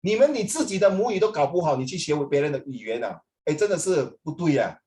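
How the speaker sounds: ordinary delivery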